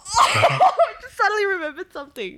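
high, loud, through helpless laughter; laughing hard. Real, not acted. Friend suddenly remembers a shared story, laughing hard. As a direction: burst out with it while cracking up.

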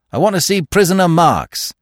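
loud, high, curt demand; terse and commanding. A narrator's reading. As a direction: state it shortly, voice pushed up. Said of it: "said Falmouth shortly".

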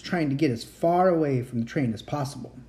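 plain reading voice

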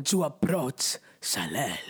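put-on purring Latin lover accent. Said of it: "I don't know why that's the accent you gave them".

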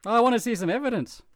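high insistent skeptic; lightly mocking skeptic. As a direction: demand proof. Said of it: "you're just like".

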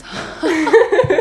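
hohes, lautes Kichern. Real, not acted. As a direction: kurz laut loskichern. Lacht mitten im Erzählen. (hohes Lachen mitten im Satz)

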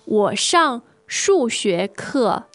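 usual speaking voice

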